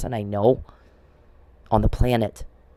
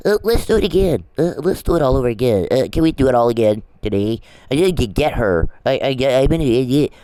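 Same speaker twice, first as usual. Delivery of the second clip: mocking Perry; 4 dB louder than usual. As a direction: mimic him as a fumbling fool.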